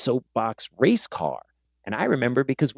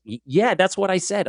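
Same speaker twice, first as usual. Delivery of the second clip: put-on Boston accent; 7 semitones higher than usual. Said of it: "had the thickest Boston accent".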